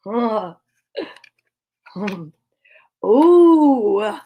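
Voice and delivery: ordinary delivery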